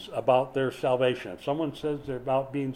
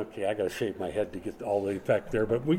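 usual speaking voice

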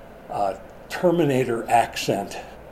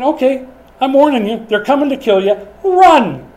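higher and louder, building to a shouted "run!"; urgent warning; 10 semitones higher, 7 dB louder than usual. Acted. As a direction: sound the alarm, rising into a cry to flee. Voicing angel warning Joseph.

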